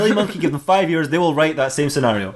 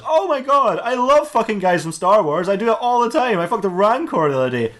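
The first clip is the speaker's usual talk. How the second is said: gushing Samantha; 8 semitones higher than usual. Acted. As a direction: gush in a high put-on voice as Samantha. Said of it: "He comes across Samantha, who goes".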